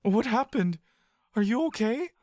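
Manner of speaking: put-on a scared child calling his friend; frightened boy. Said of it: "you say".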